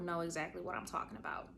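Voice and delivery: fast, whispered aside; knowing whisper. Real, not acted. Confiding to women listeners in a whisper. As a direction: let them in on it quietly.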